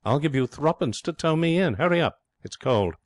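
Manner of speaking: high, airy Mifflin voice; blithe schemer. A narrator's reading. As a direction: cheerfully brush off the complaint.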